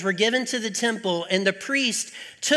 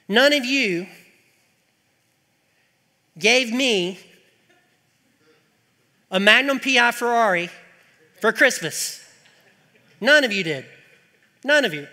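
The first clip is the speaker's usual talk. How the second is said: louder, playful mock complaint; mock-accusing tease; 2 semitones higher, 5 dB louder than usual. Real, not acted. Pastor teasing his congregation about a Ferrari, mock accusing. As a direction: scold them in jest.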